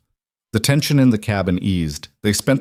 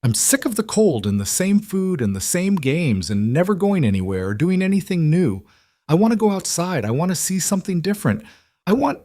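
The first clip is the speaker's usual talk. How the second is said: put-on high child's voice rising toward tears; a little girl pouring out her frustration; upset child; 10 semitones higher than usual. A narrator's reading. Young twin to her stepmother, high and upset.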